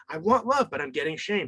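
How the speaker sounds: put-on a kid's plea peaking in a high cry for "love"; child crying out. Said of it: "You're like".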